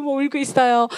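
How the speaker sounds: ordinary delivery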